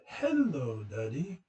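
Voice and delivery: amateur posh British daughter voice; British accent. Retold or imitated. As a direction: imitate a little English girl's accent. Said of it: "this perfect British accent, which I can't do".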